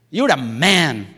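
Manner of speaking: ordinary talk, nothing marked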